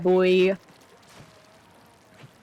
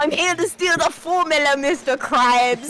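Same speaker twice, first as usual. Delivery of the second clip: British Plankton voice; 4 semitones higher than usual. Acted. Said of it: "a British plankton".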